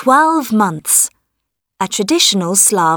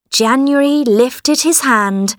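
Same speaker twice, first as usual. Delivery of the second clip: plain reading voice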